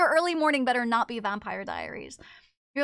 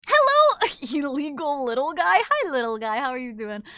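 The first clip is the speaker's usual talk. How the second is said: cooing at a pet; 6 dB louder than usual. Real, not acted. Streamer greeting a little animal, cooing. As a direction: coo at a tiny creature.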